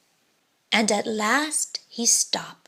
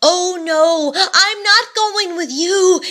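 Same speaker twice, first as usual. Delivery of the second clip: high, louder frightened protest; little pumpkin pleading with the giant; gasped protest; 7 semitones higher, 4 dB louder than usual. Acted. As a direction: squeak out a panicked refusal. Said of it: "gasped poor Mister Tip-Top".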